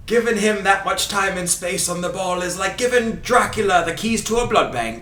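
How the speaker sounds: put-on high, loud Scottish commentator accent; hammed-up Scottish accent for football commentary; Ray Hudson accent. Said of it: "do my best Ray Hudson accent".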